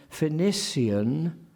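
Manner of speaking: PHOENICIAN in four syllables, "fe-NISS-ee-an"; Eliot's pronunciation. Shown as a demonstration. Lecturer shows Eliot's word, Phoenician as fe-niss-ee-an. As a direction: draw out Phoenician with a crisp S. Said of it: "not Phoenician".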